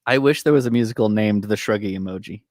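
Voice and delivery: ordinary talk, nothing marked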